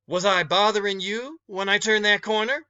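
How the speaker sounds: a hint of an indignant older woman; challenging woman. Woman confronts the boy.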